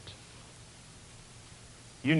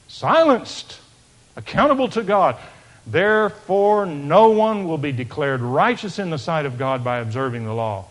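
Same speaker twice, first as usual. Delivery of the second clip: loud, raised preaching to the congregation; fervent preaching; 3 semitones higher, 8 dB louder than usual. Acted. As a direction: proclaim it, rising and louder. Preaching at full force.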